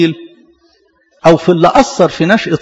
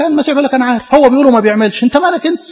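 higher, insistent preaching; emphatic quoting; 7 semitones higher than usual. Acted. Preacher voicing Christ to the congregation, higher and insistent. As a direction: drive the point home, higher. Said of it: "قال لك".

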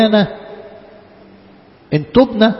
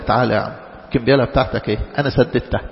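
gentle reassurance; 7 semitones lower, 3 dB quieter, much faster than usual. Acted. Benefactor voiced gently.

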